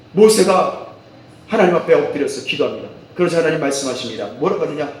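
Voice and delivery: normal talking voice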